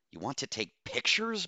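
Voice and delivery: high, incredulous question; incredulous hiss. A narrator's reading. Doctor objects to commander's photo plan, incredulous hiss. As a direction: pitch climbing in disbelief. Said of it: "Bose hissed".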